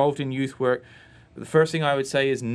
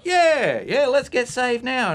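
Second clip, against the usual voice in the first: put-on high, louder, eager kid-sister voice; eager big sister; 11 semitones higher, 5 dB louder, slower than usual. Sister urging her brother to get saved at home, high and eager. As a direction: cry it out like an excited child with a plan. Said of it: "And she's like".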